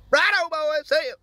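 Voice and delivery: squeaky high sign-off, clearly put on; silly high voice. Signing off to the listeners in a squeaky put-on voice. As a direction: pitch it way up like a cartoon kid.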